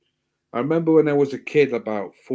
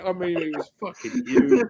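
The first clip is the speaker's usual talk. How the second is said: normal talking voice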